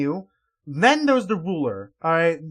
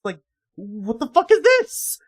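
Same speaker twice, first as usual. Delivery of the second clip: usual speaking voice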